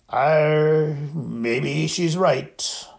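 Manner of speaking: slow, slurred voice of a giant who has been drinking; a big drunk gamekeeper, slow and thick; thick, drunk Hagrid. A narrator's reading. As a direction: drag each word out like a man in his cups.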